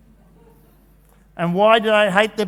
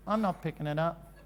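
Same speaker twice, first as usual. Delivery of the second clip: low-key, much softer defiant reply; sulky little brother; 3 semitones lower, 11 dB quieter than usual. Retold or imitated. Little brother refusing big brother's order, soft and defiant.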